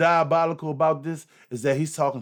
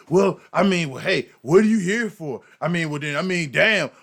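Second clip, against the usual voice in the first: mocking imitation; 4 semitones higher, 6 dB louder than usual. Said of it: "Now it's like".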